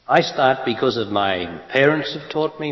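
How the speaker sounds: normal talking voice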